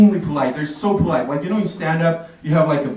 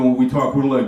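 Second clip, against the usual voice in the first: usual speaking voice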